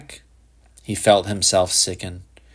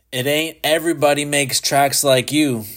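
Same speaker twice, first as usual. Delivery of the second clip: pointed accusation; 6 semitones higher than usual. A narrator's reading. Policeman taunts the boy.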